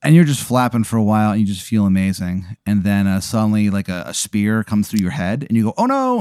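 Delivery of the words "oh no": high-pitched mock cry of dismay; mock alarm. Dreamer hit by a spear, high mock alarm. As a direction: jump way up in pitch, playfully panicked.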